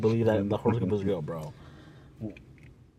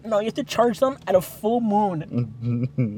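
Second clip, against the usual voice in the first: mock mystic; 7 semitones higher, much slower than usual. Mocking crystal believers.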